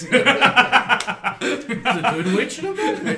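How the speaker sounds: loud, high, slow, breaking into laughter; laughing. Real, not acted. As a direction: collapse into giggles mid-line.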